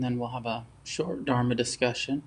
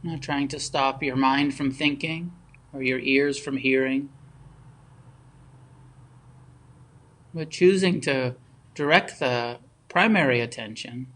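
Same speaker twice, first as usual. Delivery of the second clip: unhurried meditation guidance with long pauses between phrases; guided meditation; 3 dB louder than usual. Real, not acted. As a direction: guide them gently into stillness, phrase by phrase.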